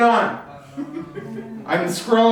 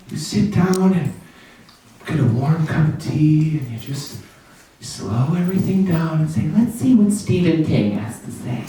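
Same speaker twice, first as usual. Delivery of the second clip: dreamy reader voice; 7 semitones lower than usual. Acted.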